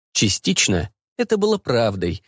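ordinary delivery, nothing marked

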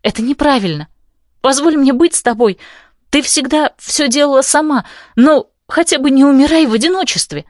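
high desperate pleading. Acted. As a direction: beg her, voice climbing high. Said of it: "умоляю я".

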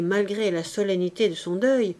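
ordinary delivery

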